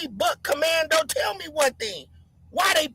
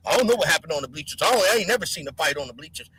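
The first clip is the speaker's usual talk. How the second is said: much lower, fast mumbling play-dumb voice; mock clueless voice; 11 semitones lower, faster than usual. Mocking viewers who pretend not to know, low fast play-dumb voice. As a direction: rattle it off low like someone faking ignorance. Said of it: "you act like".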